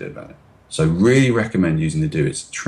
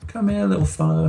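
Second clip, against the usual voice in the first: gentle to a puppy; 12 semitones higher than usual. Retold or imitated. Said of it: "how would you talk to that frightened puppy".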